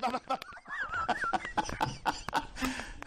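very high mock laughter; exaggerated high-pitched laugh; gleeful cackle. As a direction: cackle on after the mock blessing. Mock rampage in church parking lot.

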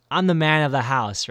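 loud mock-macho dad line; imitating dad. Voicing his dad, the man of the house, mock boast. Voicing dad's misogynistic claim.

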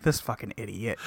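low, soft under-the-breath curse; muttered insult. Retold or imitated. Ryusei cursing Gen under his breath, low muttering. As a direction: grumble it low to yourself.

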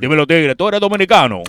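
put-on loud, bold Dominican Spanish accent. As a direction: call him out like a Santo Domingo tíguere. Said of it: "the Boricua accent".

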